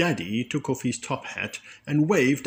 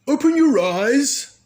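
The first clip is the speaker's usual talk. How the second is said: much higher, louder call; cried out; 8 dB louder than usual. A narrator's reading. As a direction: call out to the kids to look. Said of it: "cried Magic Daddy".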